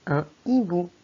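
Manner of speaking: hibou said as ibou, h silent; un hibou with no h sound. Shown as a demonstration. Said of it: "Il ne chante pas comme dans le mot repère".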